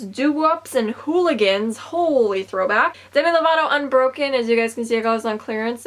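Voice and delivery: ordinary delivery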